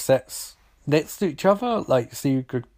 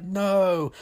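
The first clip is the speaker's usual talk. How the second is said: high mock cry of dismay; retold alarmed cry; 7 semitones higher than usual. Reviewer voices onlookers as the slick pulls her under, mock dismay. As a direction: imitate helpless onlookers, pitched up. Acted group cry during attack.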